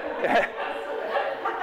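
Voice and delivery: breathy burst of laughter; suppressed giggle. Real, not acted. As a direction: let a laugh slip out. Laughing during demo.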